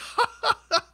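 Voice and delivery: very high staccato laugh; mock Alexa laugh. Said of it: "then she's just like".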